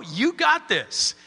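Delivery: "You got this." said slowly, stress on got; slow pep talk to a doubting listener; slow encouraging. Shown as a demonstration. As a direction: tell them "You got this." slowly and firmly. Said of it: "look at you and just say".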